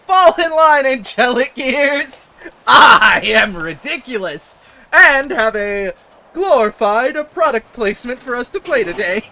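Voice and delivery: loud, slow superhero proclamation; hammy hero voice. Acted. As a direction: boom it like a cartoon mascot. Announces as Pepsi Man.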